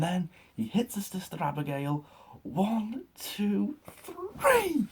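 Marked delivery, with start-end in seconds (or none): 2.4-4.9 calling the three-count like a fan, building to a high shout; excited count. Real, not acted. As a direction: count the pin, rising to a yell on three.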